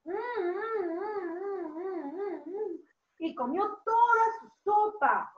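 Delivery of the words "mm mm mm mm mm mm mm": soft sing-song munching hum; eating hum. Acted. Teacher acts out Ricitos eating soup for children, soft munching hum. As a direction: hum softly as if relishing each spoonful.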